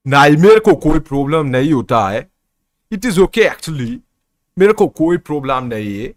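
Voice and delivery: put-on Nigerian-accented Hindi, quick and lively; Aagu Stanley's Nigerian accent. Nigerian friend answers the streamer, in his Nigerian accent. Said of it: "I'm doing the आगू Stanley accent".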